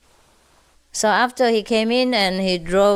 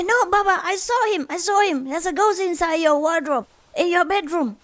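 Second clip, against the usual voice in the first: put-on much higher child voice insisting; insistent child; 8 semitones higher than usual. Five-year-old son insists to his father about the ghost, high child voice. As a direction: play the little son pleading with Papa.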